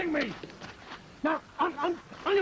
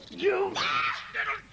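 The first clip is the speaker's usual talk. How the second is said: slow, furious outburst; angry shout; 7 semitones higher, much slower than usual. Acted. Jerry rounds on his wife for trapping him, shouting. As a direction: spit it out at the betrayer.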